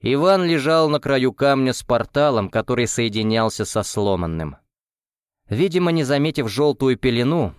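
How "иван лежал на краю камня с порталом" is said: usual speaking voice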